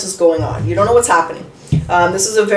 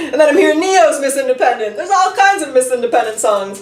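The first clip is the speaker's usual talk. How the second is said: laughing; 6 semitones higher than usual. Real, not acted. Reader amused by her own song list, laughing talk. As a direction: still chuckling through the words, higher.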